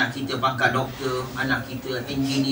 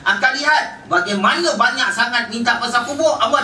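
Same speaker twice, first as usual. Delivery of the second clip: raised, fervent preaching, much higher and a little louder; preaching at full force; 10 semitones higher, 3 dB louder than usual. Acted. Preacher warning the congregation about the grave, fervent full-force preaching. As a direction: drive the warning home to the crowd.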